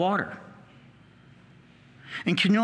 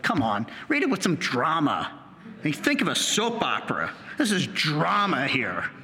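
impatient listener; 4 semitones lower than usual. Retold or imitated. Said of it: "and it's like".

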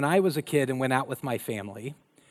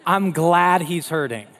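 louder, slow and satisfied; gruff dad; 8 dB louder, slower than usual. Retold or imitated. Dad telling mom he's glad the boy hurts, loud and slow. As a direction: land it like a stern told-you-so. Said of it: "he's like".

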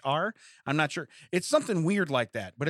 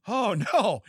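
a hammed-up gasp of alarm, higher and louder; mock alarmed oh no; 6 semitones higher, 3 dB louder than usual. Reader of the note, hammed-up mock alarm.

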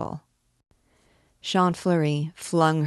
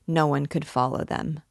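ordinary delivery, nothing marked

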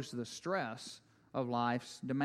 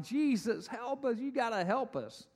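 mock whiny cry for help, higher; panicked disciples; 8 semitones higher than usual. Disciples in the boat begging Jesus, mock whiny. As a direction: whimper it like scared babies. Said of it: "crying out like a bunch of babies".

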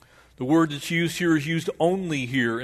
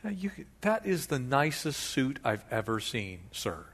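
softer, fawning compliment; sweet-talking flattery; 9 dB quieter than usual. Acted. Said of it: "you can hear what he's doing".

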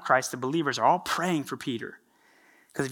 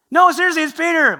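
insistent excitement; 13 semitones higher, 11 dB louder than usual. Retold or imitated. Rhoda insists to the group.

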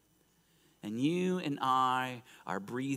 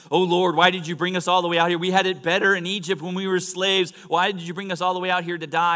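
loud, high, fast griping; griping complaint; 7 semitones higher, 11 dB louder, faster than usual. Acted. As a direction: complain to heaven in one rushed rant. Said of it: "they're griping and they're complaining".

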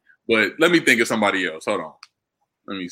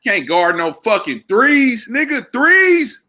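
higher and louder, slow and emphatic; loud heated argument; 8 semitones higher, 4 dB louder, slower than usual. Real, not acted. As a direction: hammer the point home, getting louder. Heated argument over player position.

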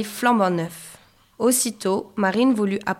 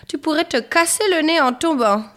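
higher, louder motherly warning; worried-warning mother; 8 semitones higher, 5 dB louder than usual. A narrator's reading. Mother warns Marine about roller skates, high scolding voice. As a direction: warn her in a raised, lifted voice. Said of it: "lui dit sa maman".

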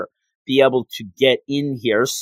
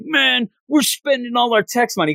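mock complaining townsperson; 9 semitones higher than usual.

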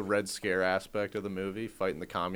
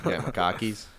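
put-on Boston accent. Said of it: "Khakis and car keys".

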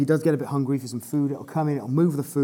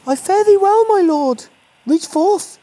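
very high, loud olde-English coaxing; Elizabethan voice; 14 semitones higher, 9 dB louder, slower than usual. Acted. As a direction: play an Elizabethan courtier coaxing. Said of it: "You see what I did there? I adapted it".

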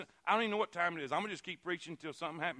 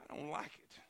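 whisper; 6 semitones lower, 11 dB quieter than usual. Acted. Voicing a sufferer, whispered.

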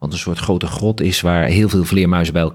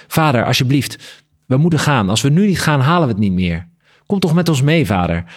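higher, urgent appeal; pleading; 7 semitones higher than usual. A narrator's reading. Bat son begging his father to leave with them, pleading. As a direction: plead urgently, pitch lifted high.

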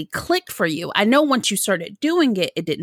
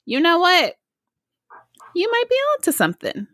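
imagined inner realization; 10 semitones higher than usual. Acted. Acting out the guest's inner realization.

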